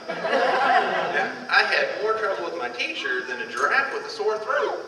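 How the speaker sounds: ordinary delivery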